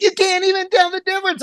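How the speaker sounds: high, quick mock of the wife; mimicking wife. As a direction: chirp it like his wife selling it. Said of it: "And she was like".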